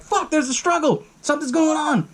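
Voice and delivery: high-pitched urgent outcry at normal volume; mock alarm. Crew comes down and finds a struggle, alarmed. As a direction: act out a startled alert, voice jumping high. Crew rushing down in retold scene.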